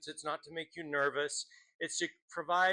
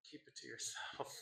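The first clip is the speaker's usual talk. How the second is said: hushed aside; 11 dB quieter than usual. Real, not acted. Host to audience on disliked poems, hushed aside. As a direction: drop it right down, as if confidential.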